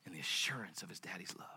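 very soft, low, near whisper with long pauses; hushed preaching. Acted. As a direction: let it sink in reverently.

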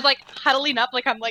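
ordinary talk, nothing marked

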